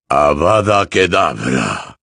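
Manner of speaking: slow, low, forceful incantation; villain casting curse. Acted. Voldemort attacking Harry, cold incantation. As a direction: pronounce the curse with drawn-out menace.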